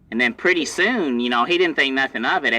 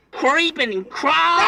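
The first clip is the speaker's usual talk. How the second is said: creepy high voice; 13 semitones higher than usual. Acted. Spooky story telling spiders.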